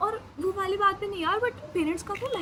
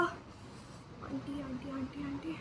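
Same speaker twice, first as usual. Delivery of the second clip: whispered, slow pleading of the name; willing the aunt to pick up, in a whisper; 3 semitones lower, 11 dB quieter, much slower than usual. Retold or imitated. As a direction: whisper "aunty" over and over, barely voiced. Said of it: "मैं कहा".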